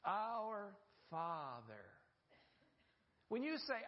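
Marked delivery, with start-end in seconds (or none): 0.0-1.8 slow reverent prayer. Acted. Opening words of the Lord's Prayer.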